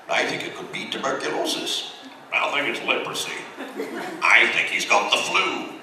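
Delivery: a string of different doctor voices, lightly done; playful doctor voices; doctors consulting. Retold or imitated. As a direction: rattle off each doctor's diagnosis in its own voice. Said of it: "And they're like".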